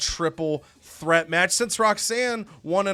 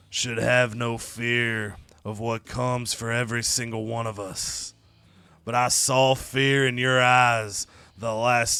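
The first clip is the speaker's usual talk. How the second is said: slow, low, drawn-out menacing impression; The Undertaker; 5 semitones lower, slower than usual. Wrestler warning Tony he will rest in peace, slow and ominous. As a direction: intone it slowly and low, like a deathly threat. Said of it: "Then The Undertaker comes out to the ring".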